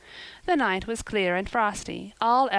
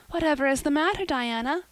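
put-on startled girl crying out to her friend at the door; alarmed cry; 8 semitones higher than usual. A narrator's reading. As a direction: call out in sudden alarm. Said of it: "cried Anne".

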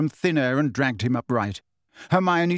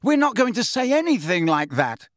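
raised, urgent protest; shout; 7 semitones higher than usual. A narrator's reading. Harry protesting to the centaurs, moderate shout. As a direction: call it out, pitched up. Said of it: "Harry shouted".

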